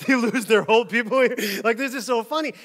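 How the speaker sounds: talking through high, hammed-up laughter; laughing. Retelling his reaction to silver alert, laughing. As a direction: laugh helplessly through the words. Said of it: "I laughed".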